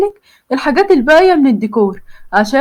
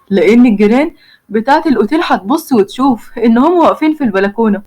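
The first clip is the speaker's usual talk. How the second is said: ordinary delivery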